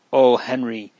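plain reading voice

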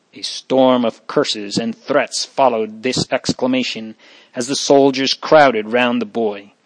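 plain reading voice

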